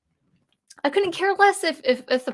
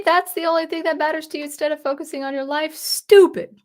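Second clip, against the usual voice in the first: mocking; 7 semitones higher, 5 dB louder than usual. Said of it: "Final Fantasy says".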